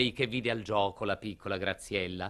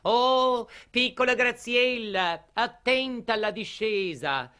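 high, loud call after someone; raised, high-pitched warning cry; 10 semitones higher, 4 dB louder than usual. Acted. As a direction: call after a cyclist riding away. Lady calls after departing cyclist.

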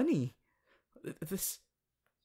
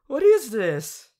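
loud, high, slow astonished question; surprised; 5 semitones higher, 11 dB louder, slower than usual. Acted. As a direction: blurt it out in shock. Discovering she has paws.

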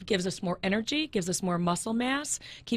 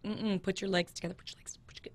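whispering; 7 dB quieter than usual. Retold or imitated. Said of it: "I'm like".

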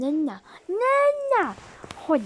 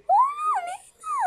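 squeaky hello; 4 dB louder than usual. Pretend. High greeting in scary game.

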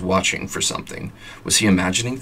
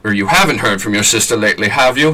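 nervous retake; 3 semitones higher, 6 dB louder than usual. Acted. Husband nervously asking wife about her sister, anxious. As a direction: redo it more anxious and pleading. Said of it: "nailed it".

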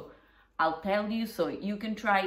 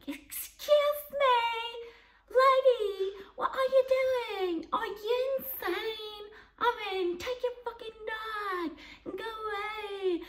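ape the outraged woman at the window high and fussy; mocking the angry neighbour. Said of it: "she was like".

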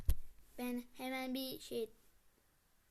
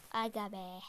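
usual speaking voice

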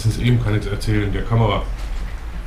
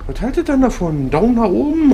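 high excited outro; 13 semitones higher than usual. Pretend. Outro catchphrase, pitch way up.

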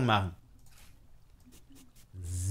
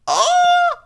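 startled yelp; 7 dB louder than usual. Real, not acted. Startled by a shadow.

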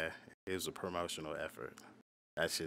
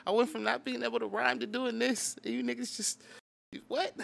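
much higher, louder, voice straining up as he recalls the loss; heartbroken rant; 5 dB louder than usual. Retold or imitated. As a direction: pour out the old heartbreak in a high, strained pitch. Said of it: "I was heartbroken".

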